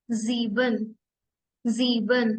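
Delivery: ज़ीबन said "zeeben" with a z; ज़ीबन repeated for students; German sieben. Shown as a demonstration. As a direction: model ज़ीबन clearly. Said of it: "seven ज़ीबन".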